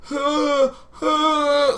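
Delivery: high, drawn-out whimpering cries; acted-out wails of shock; panicked wail. Gamer sees he's bleeding, panicked wail.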